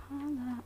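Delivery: Challah drawn out high and soft, relishing the sound; savoring the word. Shown as a demonstration. Streamer relishing saying the bread's name, high and soft. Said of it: "I just like to say it".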